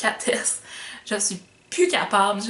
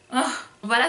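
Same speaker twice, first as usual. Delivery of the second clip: normal talking voice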